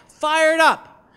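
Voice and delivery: loud, high answering chant; yelled reply. Retold or imitated. People answering the campaign staff, yelled chant. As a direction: yell it right back. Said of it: "people would yell back".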